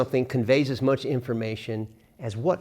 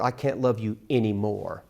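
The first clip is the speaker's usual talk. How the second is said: "anymore" set off and leaned on at the end; stress on ANYMORE; 2 semitones lower than usual. Shown as a demonstration. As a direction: put the weight on "anymore". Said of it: "And this is my favorite".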